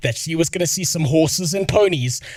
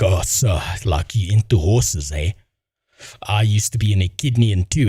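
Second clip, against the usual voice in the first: low mock bro voice; lower, dopey imitation of a flirting guy; mocking douchebag; 7 semitones lower than usual.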